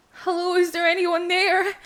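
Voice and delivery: high, loud call into darkness; anxious shouted call. Acted. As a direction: call out, hoping someone answers. Calling out into the dark.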